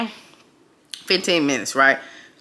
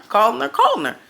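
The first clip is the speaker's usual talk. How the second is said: ordinary talk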